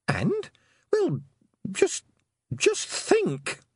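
just think, squeaked in exasperation; exasperated. Acted. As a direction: voice climbs way up in frustration. Exasperated girl pleading with toad.

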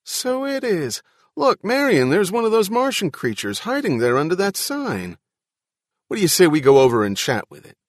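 high, loud delighted dad; grinning enthusiasm. A narrator's reading. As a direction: point it out eagerly, grinning, voice lifted high. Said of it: "the man said with a grin".

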